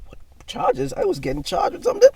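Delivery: loud, very high-pitched retold outburst; shocked disbelief. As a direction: act out being blindsided, voice shooting up. Retold shock at hearing of charges.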